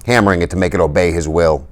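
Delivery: plain reading voice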